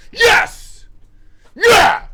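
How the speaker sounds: high, very loud victory yell; sudden screamed cheer; triumphant shout. Real, not acted. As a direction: explode with celebration at a win. Celebrates a correct answer, then apologizes.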